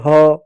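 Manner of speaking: ह said alone as an open, stretched "ha"; drawn-out ह. Shown as a demonstration. Teacher reading letters to children, drawn-out ह. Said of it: "इसको पढ़ोगे".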